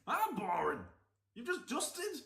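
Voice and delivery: loud, high indignant cry; mock outrage. Answering the vlogger who called others boring, hammed-up outrage. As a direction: act scandalised, voice climbing.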